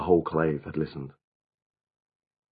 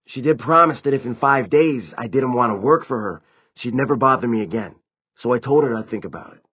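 higher, lightly acted vampire voice; higher-pitched character explaining what happened; vampire recounting events; 6 semitones higher, 6 dB louder than usual. A narrator's reading.

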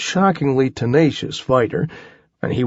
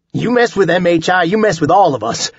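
higher, louder, fast defiance; defiant shout; 6 semitones higher, 4 dB louder, faster than usual. A narrator's reading. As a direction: fling the threat out fast. Defiant battle cry to giant.

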